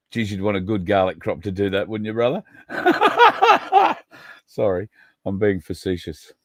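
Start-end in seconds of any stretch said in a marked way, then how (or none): 2.7-4.0 high-pitched, loud cackle; laugh. Real, not acted. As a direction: burst into a high, rapid laugh. Laughs at own teasing joke.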